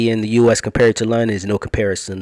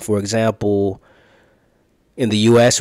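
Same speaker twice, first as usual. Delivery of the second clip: ordinary talk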